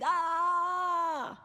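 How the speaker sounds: sudden high open-mouthed scream; mock scream. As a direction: let out a wordless protest yell. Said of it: "by screaming".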